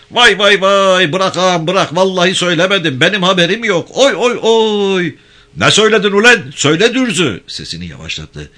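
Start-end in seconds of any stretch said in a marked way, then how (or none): 0.1-5.1 much higher, louder mock cries of pain; fake wailing. Prisoner faking cries so the guards think he's beaten. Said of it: "birden bağırmaya başladı". 5.6-7.4 much higher, louder staged bellow; mock interrogation shout. Gendarme faking a harsh interrogation for listeners outside. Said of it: "Vurdukça bağır sen".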